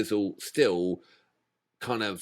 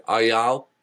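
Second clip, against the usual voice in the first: mock Texan. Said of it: "they just go".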